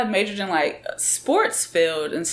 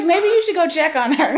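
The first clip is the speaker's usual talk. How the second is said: laughing; 10 semitones higher, 7 dB louder than usual. Retold or imitated. Retelling her teasing remark.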